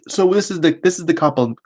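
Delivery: hiccups breaking into the words; talking through hiccups; hiccuping. Real, not acted. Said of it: "sorry, I keep hiccuping".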